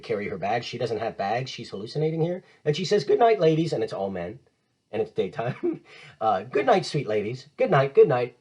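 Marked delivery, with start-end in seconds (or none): 3.0-3.7 put-on louder, airy farewell from a mad girl; mad Ophelia farewell. 6.4-8.4 lilting goodnight, swelling louder on the last two; a dreamy farewell that grows louder; mad Ophelia farewell. Acted.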